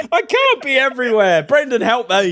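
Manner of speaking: high, loud laughing despair; laughing exasperation. Real, not acted. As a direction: laugh through the frustration. Chaos, players ignore his plan.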